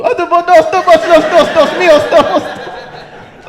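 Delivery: high, loud wailing plea; hammed-up panicked sobbing; imitating the crying man. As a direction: wail like the drunk in the cell. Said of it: "started sounding like the Mexican dude".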